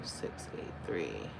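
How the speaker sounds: slow, barely voiced low murmur; muttering to self. Real, not acted. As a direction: breathe the digits out under your breath. Reads number to self while comparing.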